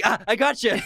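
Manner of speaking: high, breaking into a laugh; imitated gleeful laughing gotcha. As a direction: crow it as a mock triumph. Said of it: "he starts laughing and saying".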